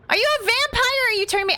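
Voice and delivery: very high playful squeal; playfully panicked, high-pitched questions; mock alarm. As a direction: question the pet in a high, silly voice. Mock alarm at biting pet.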